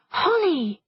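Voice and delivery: high, anxious warning call; nervous. A narrator's reading. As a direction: call the name in rising alarm. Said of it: "said Meg nervously".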